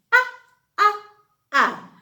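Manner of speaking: "ah" as the short u in umbrella, repeated; short u sound. Shown as a demonstration. Teacher sounding out the letter U to the class, short uh. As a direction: sound out "ah" short, three times. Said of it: "U says".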